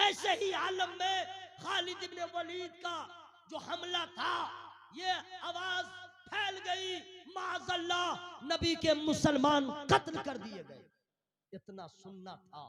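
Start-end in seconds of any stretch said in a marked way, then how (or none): 0.0-10.9 high, loud, sustained crying out; full-force preaching. Real, not acted. Preacher spreading the dreadful battle rumour, crying out. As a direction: cry it out phrase by phrase.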